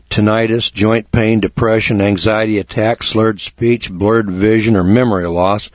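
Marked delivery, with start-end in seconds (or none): none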